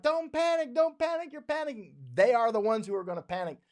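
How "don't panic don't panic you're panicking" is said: much higher, sneering mimicry of scolds; mocking high whine. Mocking the people who say don't panic, high sneer. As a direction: parrot them in a high mocking voice. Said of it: "the people that are like".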